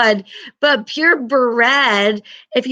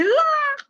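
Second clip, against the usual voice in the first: surprised yelp; 3 dB quieter than usual. Real, not acted. Slipping while writing on board.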